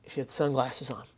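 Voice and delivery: ordinary delivery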